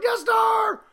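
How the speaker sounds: high excited shout; seeing the Death Star, shouting in excitement. Retold or imitated. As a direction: shout the name like a kid. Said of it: "I'm like".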